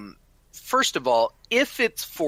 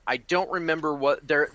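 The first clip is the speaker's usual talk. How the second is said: usual speaking voice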